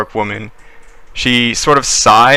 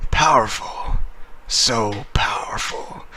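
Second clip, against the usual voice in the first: put-on breathy power-drunk hush; 8 dB quieter than usual. GM voices transformed dwarf feeling power.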